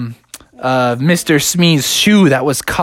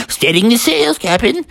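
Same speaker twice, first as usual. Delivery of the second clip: higher voice, rising at the end; eager first mate Smee; 8 semitones higher than usual. A narrator's reading. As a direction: echo the order obediently.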